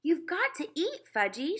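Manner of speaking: high, sweet, coaxing mother voice; a mother sweet-talking her child into eating; mother urging her little son to eat, coaxing. Acted. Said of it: "she said".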